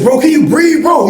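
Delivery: high alarmed call; worried shout. Retold or imitated. Narrator calling to fallen friend, high worried shout. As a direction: yell down to him in worry. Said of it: "I said".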